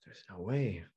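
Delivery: disbelieving inner thought. Retold or imitated. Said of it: "And I'm thinking".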